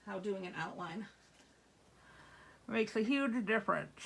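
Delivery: talking around a pen cap; muffled by a pen cap in the mouth. Real, not acted. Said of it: "I have the pen cap in my mouth".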